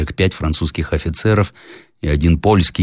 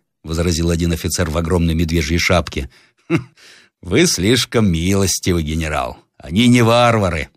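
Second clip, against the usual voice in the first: ordinary delivery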